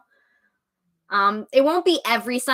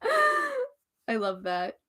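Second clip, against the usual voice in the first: high, slow laughing I love that; 13 semitones higher, 3 dB quieter, much slower than usual. Real, not acted. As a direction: laugh through the words. Laughing at own Aries joke.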